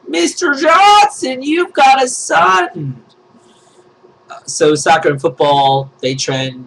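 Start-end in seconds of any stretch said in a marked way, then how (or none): 0.1-3.0 much higher, louder, slow congratulatory cry; calling out. Acted. Congratulating Mister Johnson on his son with a slow, high, loud call. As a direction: call it across the room like big news.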